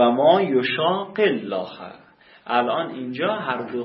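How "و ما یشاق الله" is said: و ما یشاق الله recited slowly and a bit louder; slow careful correction. Shown as a demonstration. Teacher correcting a student's verse, slow and deliberate. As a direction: set the student right with a slow, clear recitation. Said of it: "corrects student's recitation".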